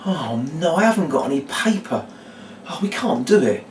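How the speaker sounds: earnest, acted disappointment; sincere helplessness. Shown as a demonstration. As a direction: play it as genuinely stuck. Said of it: "real kind of sincerity about not being able".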